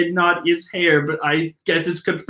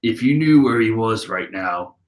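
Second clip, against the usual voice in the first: deep Katakuri voice; 8 semitones lower than usual. Retold or imitated. Katakuri's deep voice used by mistake for Brulee's line. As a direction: rumble it like the big brother. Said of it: "That's Brulee talking".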